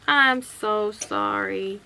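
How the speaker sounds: imitating an apologetic husband; drawn-out apology. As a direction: stretch out a retold sorry.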